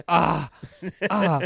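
loud, high swooning "ah"; mock swoon. Date reacting to the star's accent, mock swoon. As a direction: imitate a delighted swooning cry.

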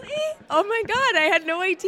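high, laughing gasp of surprise; delighted surprise. Real, not acted. Guest hears she's number one, surprised and laughing. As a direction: squeal it as if you truly had no idea. Host names her top podcast.

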